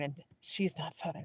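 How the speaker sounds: plain reading voice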